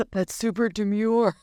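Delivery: slow, sultry put-on voice; sexy voice. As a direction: purr it out, slow and seductive. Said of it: "I gotta put my sexy voice on".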